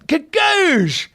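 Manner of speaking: high, drawn-out triumphant burst; triumphant exclamation. Retold or imitated. Actor boasting he nailed the audition, triumphant exclamation. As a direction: punch it high like a perfect hit. Imitating the nailing of the part.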